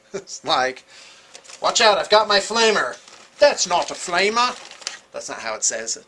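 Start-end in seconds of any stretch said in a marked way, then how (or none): 1.6-3.0 higher, louder mock warning; hammed-up warning shout. Mimics the film flamer exchange. 3.4-4.5 louder, higher mock Australian accent; hammed-up Australian retort. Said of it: "how do you say that in an Australian accent".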